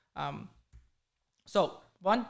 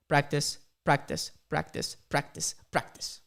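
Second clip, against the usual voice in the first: slow, spaced-out repeats, lower at the end; slow repetition; 4 semitones lower, slower than usual. Real, not acted. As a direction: let each "practice" drop separately. Repeating the advice word by word.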